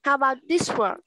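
ordinary talk, nothing marked

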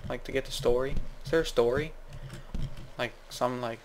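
ordinary talk, nothing marked

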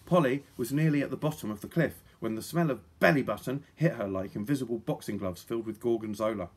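ordinary delivery, nothing marked

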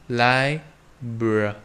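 "Library" very slow, lips closing on the b; slow library demo. Shown as a demonstration. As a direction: slowly spell out "Library", lips together for b. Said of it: "sau đó ngậm môi âm bờ".

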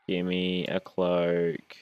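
"Give me a cloak." stretched out slowly, each word dragged; slow weary plea. Real, not acted. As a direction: drag out the plea as hope fades. Begging the game for loot.

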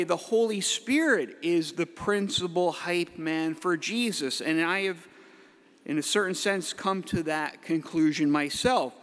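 usual speaking voice

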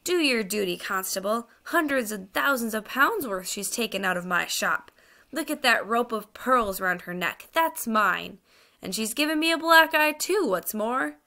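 aggrieved shopkeeper complaining to the constable; indignant fat man. Acted.

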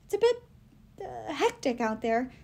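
hint at danger without saying it; a skittish servant's understatement; nervous hedging. Acted.

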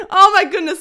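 amused oh my goodness; laughing. Real, not acted. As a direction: laugh it out in surprise. Laughing at the position.

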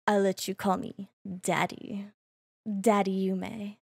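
low, soft, suggestive tease; teasing. Real, not acted. VTuber offers fans a nickname, teasing.

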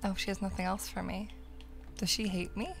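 normal talking voice

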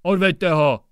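loud, barked command; roaring order. A narrator's reading. District judge roaring at the gendarme to take him away. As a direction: roar it at the guard. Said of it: "zařval okresní soudce".

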